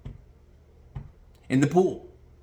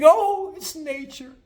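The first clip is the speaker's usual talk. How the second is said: high, airy put-on voice; mocking naive wonder; mock liberal idealist; 10 semitones higher, slower than usual. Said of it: "liberal-minded thinking".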